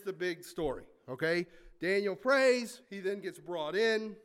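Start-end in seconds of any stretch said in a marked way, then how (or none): 1.8-2.8 Daniel prays, drawn out slow and loud; stressed slowly. Shown as a demonstration. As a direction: lean hard on prays, slowly. Emphasizing key story beat.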